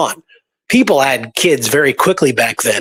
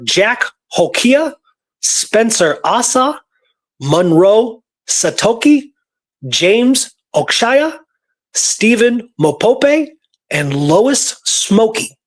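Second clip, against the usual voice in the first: slow, careful, higher reading of each name; 7 semitones higher, slower than usual. Real, not acted. Reading out six Kiowa students' names to her cohost, slow and careful. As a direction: sound out every name slowly to get it right. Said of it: "do my best to read them, eh, properly".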